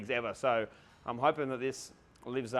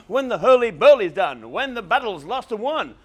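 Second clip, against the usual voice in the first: theatrical witch recitation; 6 semitones higher, 7 dB louder than usual. Pretend. Reciting Macbeth witches' lines.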